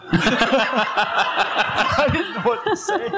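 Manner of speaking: words squeezed out through high laughter; laughing. Real, not acted. Telling the congregation the pastor's joke, laughing. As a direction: laugh helplessly as you get it out. Laughing while retelling the surprise.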